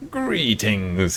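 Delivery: a hint of a wise old lizard greeting; old kobold voice. Old kobold greeting the party, lightly voiced creature. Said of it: "he says".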